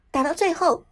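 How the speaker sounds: plain reading voice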